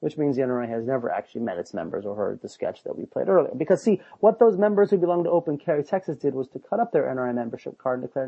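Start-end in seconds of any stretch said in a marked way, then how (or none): none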